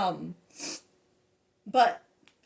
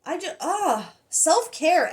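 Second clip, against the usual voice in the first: ordinary delivery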